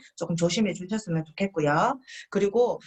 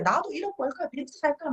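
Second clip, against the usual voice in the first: higher, dreamy boasting; mock excited brag; 7 semitones higher than usual. Partner dreaming of money and a Benz, mock excited. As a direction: play the dazzled partner. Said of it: "너무 흥분해서 막 이렇게 얘기해".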